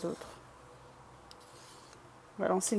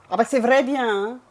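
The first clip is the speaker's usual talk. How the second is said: rural Bresse accent with some punch; broad Bressan country turn of phrase; 8 dB louder than usual. Shown as a demonstration. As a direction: chat like a Bresse local, loudly. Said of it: "Alors, l'accent bressan".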